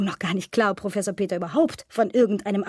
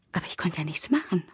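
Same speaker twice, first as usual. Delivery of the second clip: whisper; 4 semitones lower, 6 dB quieter than usual. Acted. Erzählerin flüstert resigniert.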